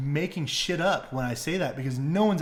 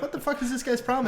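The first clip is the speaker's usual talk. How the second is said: high, quick incredulous question; acted bewilderment; 11 semitones higher, faster than usual. Host as onlookers watching the meltdown, incredulous. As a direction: rattle it off as a baffled onlooker, pitched up. Crowd reacting to the freak-out.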